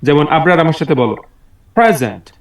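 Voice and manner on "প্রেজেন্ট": প্রেজেন্ট with firm stress on the first syllable; stress demo. Shown as a demonstration. Teacher modelling প্রেজেন্ট for a young pupil, stressed up front. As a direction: press hard on the opening of প্রেজেন্ট. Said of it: "একটু জোর বা চাপ দিয়ে পড়া".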